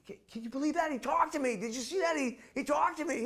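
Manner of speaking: very high, awed gushing; ecstatic disbelief. Retold or imitated. A boy gushes to the monk, very high and excited. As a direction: gush in disbelief.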